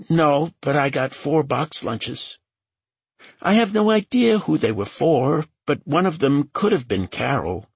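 high-pitched, anxious character voice; nervous young assistant. A narrator's reading. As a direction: answer questioning in a high, hesitant voice.

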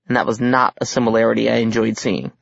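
usual speaking voice

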